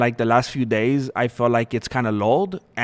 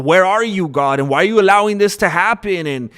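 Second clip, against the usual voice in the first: higher, louder pleas of an anguished psalmist; pleading lament; 6 semitones higher, 4 dB louder than usual. Retold or imitated. David crying out to God, pleading in anguish. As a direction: cry out to God, asking why.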